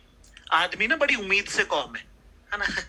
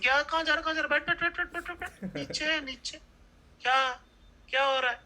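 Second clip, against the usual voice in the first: put-on higher, bossy girlfriend pinning him down; lightly voiced bossy girlfriend; mock girlfriend; 7 semitones higher than usual. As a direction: order him to sit like a suspicious partner.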